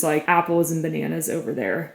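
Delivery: usual speaking voice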